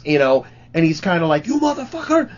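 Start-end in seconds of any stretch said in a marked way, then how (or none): 1.5-2.3 high-pitched furious yelling at the guy; retold angry yell. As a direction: yell it up high like you're screaming at him. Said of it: "He's, like, yelling at this guy".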